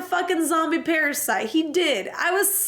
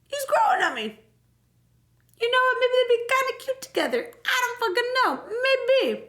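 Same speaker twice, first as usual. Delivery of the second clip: much higher, playful self-mimicry; giddy high voice; 10 semitones higher than usual. Player admitting Steve grows on her, high giddy voice. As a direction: squeal the admission in a high voice. Acts own reluctant warming to Steve.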